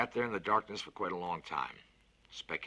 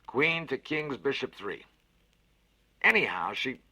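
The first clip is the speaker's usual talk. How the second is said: a big cheerful voice, lifted and louder; hearty Fats, louder and higher; 9 semitones higher, 10 dB louder, slower than usual. Acted. Fats cheers up the scared boy with a bet, hearty and loud. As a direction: sell the bet with gusto. Konski's move.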